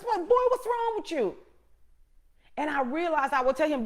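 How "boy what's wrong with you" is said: much higher, exasperated mom snapping at her son; a retold mother's sharp rebuke, pitched way up; mock scolding mother. Mother scolding son kicking walls.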